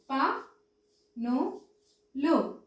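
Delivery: పనులు split as ప, ను, లు with pauses; separated syllables ప, ను, లు. Shown as a demonstration. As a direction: dictate ప, ను, లు one at a time. Teacher dictates word by syllables.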